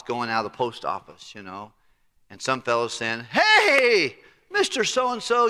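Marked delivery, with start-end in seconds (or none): 3.3-5.5 high, loud greeting across the street; hailing. Retold or imitated. Townsman greets the boss outside, calling out. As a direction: hail him from afar.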